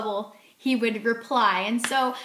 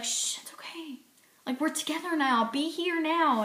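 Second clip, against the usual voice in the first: much softer hushed reassurance; calm her down gently, like a loving husband; soothing husband; 4 semitones higher, 5 dB quieter than usual. Retold or imitated.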